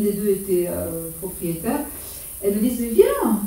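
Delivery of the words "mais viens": imitating an eager invitation. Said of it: "comme, comme ça".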